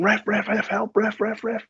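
veel hoger, 'Ref, ref, ref' hulpeloos gillend; mock panicked scream. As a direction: gil om de scheidsrechter als een bange schurk. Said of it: "now I'm screaming".

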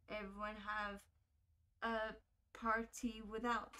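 slow, soft, level read with halting gaps; reading aloud. Acted. Player reading a found letter aloud, halting reading. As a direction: read it out word by word, quietly.

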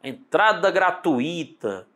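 "gratuita" slowly as gra-tu-I-ta; drawn-out mistaken "gratuita", u and i apart; wrong hiatus. Shown as a demonstration. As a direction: split "gratuita" with the stress on I. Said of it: "Ah, para com isso".

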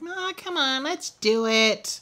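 squeaky high, slowed urging; high-pitched teasing nudge to record; mock Erica voice. Co-host mimics Erica coaxing him to record, high squeaky voice.

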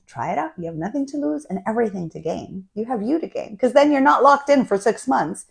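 ordinary talk

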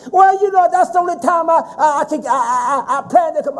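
mimicking sister. Preacher mimics his sister pleading on the phone, whiny and high. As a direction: imitate the sister stammering her excuse. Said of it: "I said".

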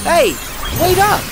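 loud, high call after someone walking off. Acted. Sora calls after Riku who is leaving, loud call. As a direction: shout to catch up with a friend.

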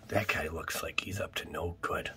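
soft unvoiced whisper; conspiratorial card-opening whisper. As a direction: lean in and whisper it. Hushed remark on card art.

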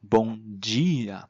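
Bom dia, slowly, dia as "djee-a"; Brazilian G sound. Shown as a demonstration. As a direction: demonstrate Bom dia with di like "jee". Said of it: "就是发成G的音". (放慢示范Bom dia，di读成“ji”)

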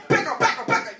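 high, rapid repeated order; panicky mock warning. Driver shooing man from his car, high and urgent.

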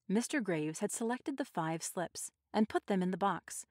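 usual speaking voice

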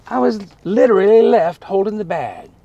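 ordinary talk

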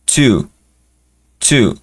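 "two" with a crisp, aspirated T; two with spat T. Shown as a demonstration. Teacher models "two" with a spat T. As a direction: spit the T of "two" a little. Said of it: "El two es una T un poquito escupidita".

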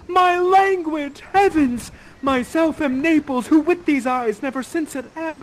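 high, loud theatrical exclamation; crying out. Acted. Ferdinand hearing his own language, crying out. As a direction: exclaim it slowly and grandly, up high. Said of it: "you cry out".

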